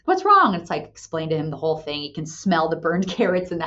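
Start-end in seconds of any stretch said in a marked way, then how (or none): 0.0-0.5 high, loud mock-husband asking what's wrong; husband's concern. Husband comes home to frustrated wife, high loud concerned question. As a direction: lightly voice the worried husband.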